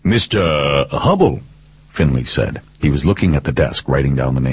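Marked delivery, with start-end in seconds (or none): 0.0-1.4 lightly put-on official caller; higher, as if checking a customer's name; a hint of a service caller; phone company pose. Detective posing as phone company man.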